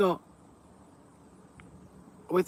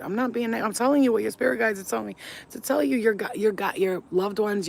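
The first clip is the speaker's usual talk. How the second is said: normal talking voice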